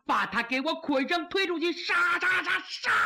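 enraged ruler roaring the execution order; furious emperor. Acted. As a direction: rage building to a shouted command. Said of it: "可把赵光义气坏了".

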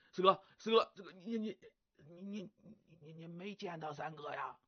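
hushed, stammering question; sneaking a quiet question to brother. Acted. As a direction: keep it low so nobody hears. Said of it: "还得压低声音说话".

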